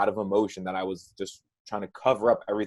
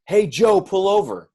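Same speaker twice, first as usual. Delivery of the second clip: raised, louder backseat yell; acted yell; 9 semitones higher, 7 dB louder, slower than usual. Growing backseat child yells at the driver, acted yell. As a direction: yell it higher, short of a full scream. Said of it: "and starts to yell".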